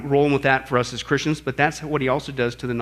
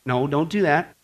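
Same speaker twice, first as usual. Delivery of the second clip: mild correction. Acted. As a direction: gently warn without raising the voice. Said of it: "he speaks to our conscience".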